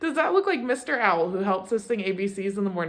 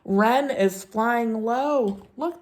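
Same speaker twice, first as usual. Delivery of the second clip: ordinary talk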